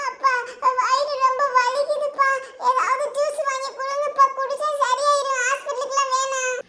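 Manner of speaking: tearful put-on pleading to father; faked stomach ache. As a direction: whine through pretend pain. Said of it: "அது சும்மா நடிப்பு". (வலியில் அழுவது போல கெஞ்சல்)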